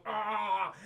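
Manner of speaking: drawn-out choking gurgle; mock strangled groan. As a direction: groan as if through a pierced throat. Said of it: "knife in his throat".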